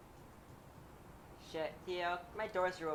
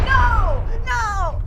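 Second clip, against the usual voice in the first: loud, high cry of protest; dismayed shout; 11 semitones higher, 14 dB louder than usual. Real, not acted. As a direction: yell it out in sudden dismay. Dismay at game setback.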